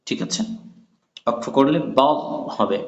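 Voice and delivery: ordinary talk, nothing marked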